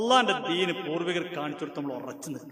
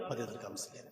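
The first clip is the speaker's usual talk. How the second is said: quiet; 5 semitones lower, 12 dB quieter, faster than usual. Real, not acted. Answering own question aside.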